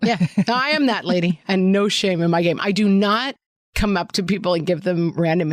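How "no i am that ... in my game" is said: ordinary talk, nothing marked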